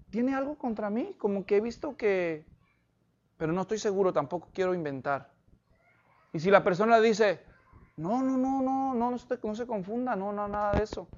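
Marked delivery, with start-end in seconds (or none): 0.1-5.3 tentative. Acted. Models a tentative, careful question. 8.0-11.1 hushed, hurried string of denials; acted soft reassurance that nothing is wrong. Acts out person denying any offence.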